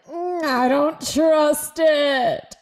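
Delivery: high, slow doubtful drawl; suspicious voice. Acted. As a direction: pull back, wary. Body distrusting love.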